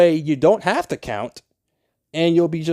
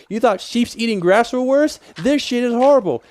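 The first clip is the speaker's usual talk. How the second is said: high, raised voice ranting at the water; half-acted fury at endless water; mock rant; 8 semitones higher than usual. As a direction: replay the moment you lost it, hammed up. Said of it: "And I'm just like".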